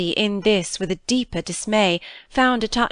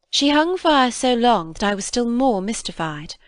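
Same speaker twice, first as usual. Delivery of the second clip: plain reading voice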